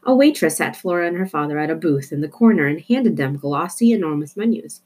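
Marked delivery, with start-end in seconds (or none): none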